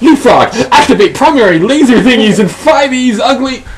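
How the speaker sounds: put-on high, loud kid's command; excited battle cry. Kid ordering his robot frog to attack, gleeful and shrill. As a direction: shout the attack order with glee.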